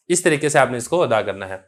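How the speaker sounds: normal talking voice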